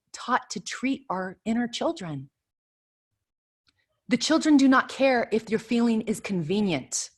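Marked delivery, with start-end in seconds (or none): none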